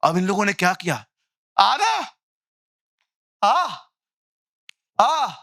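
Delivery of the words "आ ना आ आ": high, pleading mock call. Priests calling out to Baal. (ऊँची आवाज़ में गिड़गिड़ाती पुकार)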